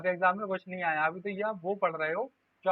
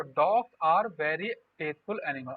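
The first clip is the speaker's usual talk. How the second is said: slow dictation; 3 semitones lower, much slower than usual. Shown as a demonstration. Teacher reading the example sentence to students, slow and deliberate. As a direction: spell out "dogs are very faithful animal" slowly for the class to copy.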